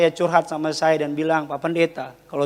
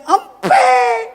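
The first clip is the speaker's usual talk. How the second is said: very high screamed wail; imitated toddler scream; 5 dB louder than usual. Toddler crying to papa for his pacifier, imitated screaming wail. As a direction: shriek like a crying toddler demanding. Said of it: "dia teriak".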